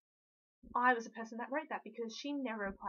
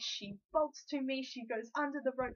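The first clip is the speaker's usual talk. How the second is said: ordinary talk, nothing marked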